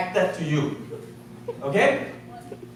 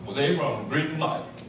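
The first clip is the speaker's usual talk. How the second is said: slow, lower and softer stiff salesman greeting; formal persona; 4 semitones lower, 5 dB quieter, slower than usual. Retold or imitated. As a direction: put on a stiff, businesslike front. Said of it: "We give this formal face".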